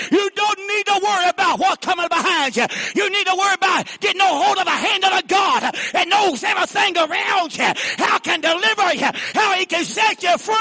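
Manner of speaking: high, loud, shouted sermon; preaching at full force. Acted. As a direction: drive it home to the congregation.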